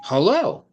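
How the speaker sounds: "hello" swung up high on "lo"; stress on second syllable. Shown as a demonstration. Teacher contrasts it with Jell-O, "hello" stressed on "lo". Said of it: "the accent is on the low".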